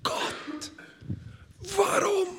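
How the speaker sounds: breathy whispered plea, rising high on warum; inner cry to God. Retold or imitated. Believer silently crying out to God, whispered. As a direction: whisper the question up toward heaven. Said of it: "schreie ich innerlich zu Gott".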